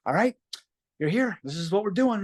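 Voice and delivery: high, loud self pep talk, pumped; pumping himself up before the act; psyching himself up. Retold or imitated. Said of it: "and I went".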